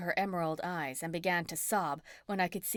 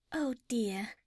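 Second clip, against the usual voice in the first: sweet angelic voice; 4 semitones higher, 3 dB quieter than usual. A narrator's reading. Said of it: "the sweet angelic voice".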